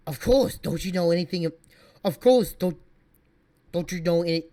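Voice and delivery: badly faked German accent, pushed loud; attempted German accent. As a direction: have a clumsy go at a German accent. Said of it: "trying to do a German accent".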